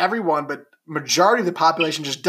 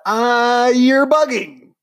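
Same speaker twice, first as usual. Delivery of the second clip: excited; 6 semitones higher, 6 dB louder, much slower than usual. Real, not acted. Teasing friend over wrong fact.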